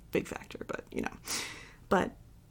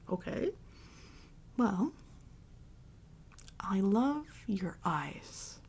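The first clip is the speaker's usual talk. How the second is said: usual speaking voice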